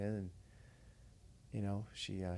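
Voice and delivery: ordinary talk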